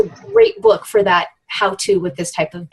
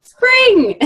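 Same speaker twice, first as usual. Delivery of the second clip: excited echo; 5 dB louder than usual. Real, not acted. Speaker echoes answer excitedly.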